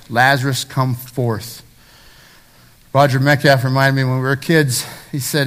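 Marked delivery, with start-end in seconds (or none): none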